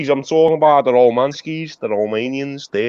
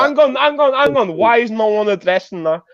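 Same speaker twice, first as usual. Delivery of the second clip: high, insistent cut-in; urgent interruption; 6 semitones higher than usual. Real, not acted. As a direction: jump in to stop the other speaker. Cuts in to object.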